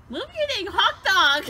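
yelling playfully over the fire; shouting. Real, not acted. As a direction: bark it out, half laughing. Fighting over fire spot, yelling.

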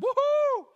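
high, loud hammed-up whoop of glee; silly joyful yelp way up high; mock whoop. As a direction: whoop like a careless fisherman showing off. Mock carefree fishing whoop.

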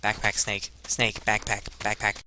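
ordinary talk, nothing marked

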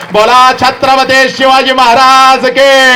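loud, high, drawn-out rallying shout; जयघोषाची हाक. Real, not acted. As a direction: lead the crowd in a long, rousing slogan cry. श्रोत्यांकडून जयघोष वदवून घेतो. (जयघोषाची उंच, लांबवलेली आरोळी)

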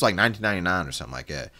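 ordinary delivery

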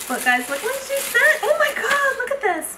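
high, excited squeak; real giddy amazement; thrilled disbelief. Real, not acted. Thrilled showing what was sent.

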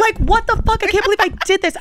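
high-pitched, exasperated outburst; can't believe your own blunder; mortified disbelief. Real, not acted. Storyteller aghast at own handshake fail, high and exasperated.

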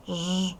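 sustained buzzing ZH for "Ж"; drawn-out sound. Shown as a demonstration. Teacher showing pupils if it is voiced, drawn-out "Ж". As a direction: hold "Ж" as one long voiced sound. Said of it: "глухий чи дзвінкий".

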